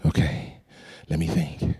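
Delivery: very low, soft murmur; hushed thinking. Retold or imitated. Oldest kid thinking for the frightened group, hushed. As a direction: drop right down as if pondering a plan. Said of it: "So we get real quiet".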